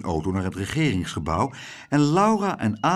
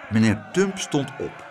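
ordinary delivery, nothing marked

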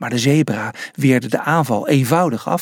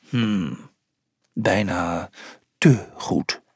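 low, slightly hushed musing; low, doubtful reply with pauses; suspicious onlooker; 7 semitones lower, 4 dB quieter, much slower than usual. A narrator's reading. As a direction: ponder it in a low character voice. Second watcher answers suspiciously.

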